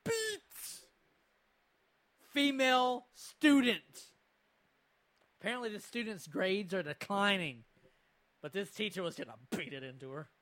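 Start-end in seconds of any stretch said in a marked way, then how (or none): 0.0-3.8 slow, loud headline delivery; dramatic title read. Acted. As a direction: announce it like a shock headline. Reads the video title dramatically.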